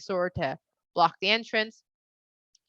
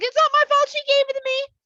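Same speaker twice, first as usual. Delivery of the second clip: put-on much higher, louder childish whine; whiny man; 7 dB louder than usual. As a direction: whine it out like a caught kid. Man blames woman.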